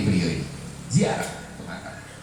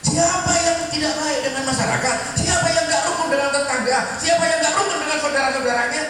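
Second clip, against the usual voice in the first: raised, louder rhetorical preaching; 12 semitones higher, 4 dB louder than usual. Acted. Preacher challenges congregation with questions, raised. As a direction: drive each question home to the crowd.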